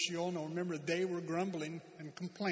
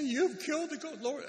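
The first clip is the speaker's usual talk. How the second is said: high, louder whiny grumble; a put-on griping voice, pitched up; mock complaining; 8 semitones higher, 5 dB louder than usual. Preacher mimics the people griping against Moses. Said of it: "murmur and complain against Moses".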